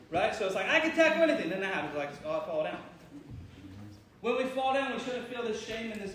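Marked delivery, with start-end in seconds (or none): 0.7-1.5 higher, louder mock boast; confident boast. Acted confident self again. 2.2-2.8 lower, deflated mock defeat. Acted defeat after boast.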